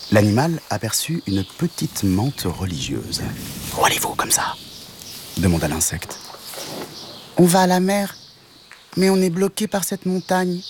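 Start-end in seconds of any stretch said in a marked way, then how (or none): 3.8-4.5 whispered praying mantis; tiny whisper voice. Acted. Mantis asks the travellers from a bush, tiny whisper. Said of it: "une toute petite voix". 7.4-10.6 much higher cat explaining; little cat voice. Acted. Cat tells the mantis they're blocked, high cat voice. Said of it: "répondit le chat".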